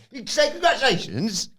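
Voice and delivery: very high, needling sing-song; mock teasing prod. [name] needling Dom to congratulate her, very high tease. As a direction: nudge him to say it.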